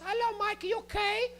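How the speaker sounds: put-on high, accented mom checking on her kid; high mock-mom voice; immigrant mom. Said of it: "and she goes".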